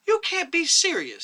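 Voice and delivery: high, loud burst of disbelief; the young doctor can't believe his ears; stupefied. A narrator's reading. Stunned young doctor protests to the chairman, high and loud.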